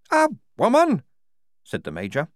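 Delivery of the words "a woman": high, loud disbelieving cry; shocked. A narrator's reading. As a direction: blurt it out in disbelief. Major shocked by the news.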